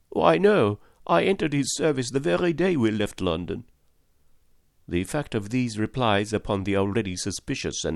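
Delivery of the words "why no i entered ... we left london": higher, eager chattering servant voice; excitable French valet. A narrator's reading. Valet telling Fix of Paris, eager higher voice. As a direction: gush like a young valet abroad.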